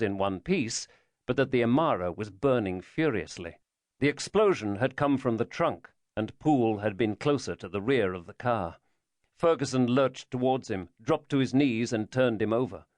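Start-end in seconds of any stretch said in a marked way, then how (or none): none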